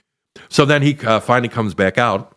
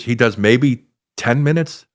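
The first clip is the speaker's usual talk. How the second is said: ordinary talk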